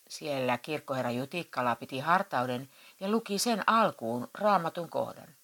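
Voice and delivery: usual speaking voice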